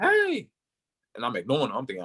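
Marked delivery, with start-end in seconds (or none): 0.0-0.5 high-pitched shout from a passing driver; imitated yell. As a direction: mimic a driver yelling to someone. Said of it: "He yelled, he was like".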